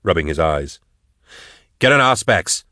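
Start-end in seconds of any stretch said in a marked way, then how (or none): 1.8-2.6 raised-pitch, curt command; giving an order. A narrator's reading. Max ordering the hunters about, commanding. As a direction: order it done at once. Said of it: "Max ordered".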